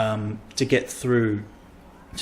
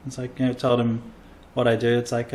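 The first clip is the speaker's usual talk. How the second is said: normal talking voice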